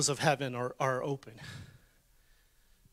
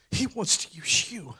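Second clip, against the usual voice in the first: whispered. Real, not acted. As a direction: lean in and whisper the promise. Intimate whispered sermon moment.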